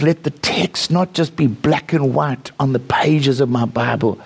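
ordinary talk, nothing marked

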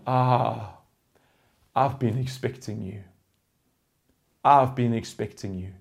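soft, low and unbothered; calm welcome. Pretend. Believer meeting suffering, calm and quietly welcoming. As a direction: greet it quietly like an expected guest.

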